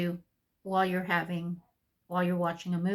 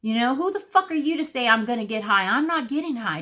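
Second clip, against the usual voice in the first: loud, fast, higher-pitched indignation; retold angry outburst; 5 semitones higher, 5 dB louder than usual. Client retorts to counselor's prediction, retold indignant outburst. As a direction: fire back, reenacting how mad you were. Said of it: "I was so mad at him".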